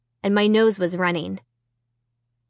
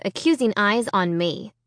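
usual speaking voice

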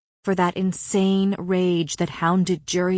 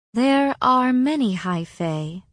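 slow, slightly higher, measured delivery; careful; 5 semitones higher, 3 dB louder, slower than usual. A narrator's reading. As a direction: pick the words with care, drawn out. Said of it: "Mor said carefully".

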